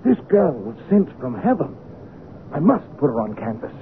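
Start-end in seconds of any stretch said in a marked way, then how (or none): 0.0-1.8 high-pitched, rapt excitement; exalted artist, voice lifted high; feverishly inspired. Acted. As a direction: declare it like a man seized by a vision. Painter seized by inspiration.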